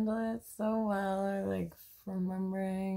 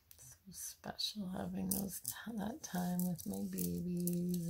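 softer, breathy, unvoiced words; soft whisper; 3 semitones lower, 6 dB quieter than usual. Real, not acted. Mom reflecting on time with her babies, in a soft whisper. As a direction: whisper it tenderly, like a secret.

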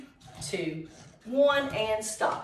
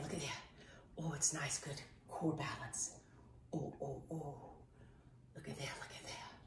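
soft breathy whisper; whispered awe; 8 semitones lower, 20 dB quieter, faster than usual. Real, not acted. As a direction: whisper it, impressed. Marvelling at balance, whispering.

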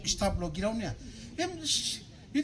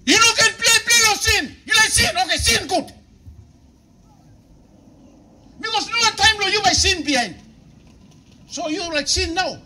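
loud, higher preaching with shouted lines; full-force preaching; 8 semitones higher, 7 dB louder than usual. Acted. Pastor urging the congregation to choose, loud preaching. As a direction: drive the choice home at full volume.

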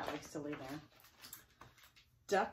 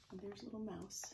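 ordinary delivery

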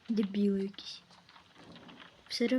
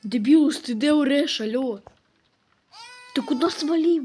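loud exasperated shout; 10 dB louder than usual. Real, not acted. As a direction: yell through the house after him. Shouting for the lost baby. (гучний роздратований крик)

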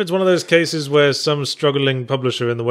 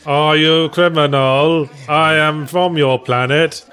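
slow, deliberate alien-officer delivery; strange officer voice; 2 semitones higher, 4 dB louder than usual. Acted. Questioning the player as the game's officer, stilted alien voice. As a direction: play an alien posing as police.

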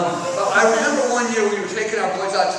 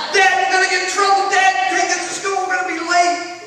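put-on much higher, louder kid's voice, fading off; kids pleading; 11 semitones higher, 5 dB louder than usual. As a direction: plead like a worried child.

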